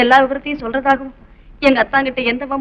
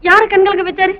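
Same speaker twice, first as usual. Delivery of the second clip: higher, louder urgent questions; agitated questions; 7 semitones higher, 10 dB louder than usual. Acted. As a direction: press him for the answer. Anxious questioning about the girl. (பதற்றமான கேள்விகள்)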